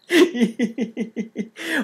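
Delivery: high rising laugh at yourself; self-mocking laugh. Shown as a demonstration. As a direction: laugh at your own situation. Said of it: "laughing at yourself".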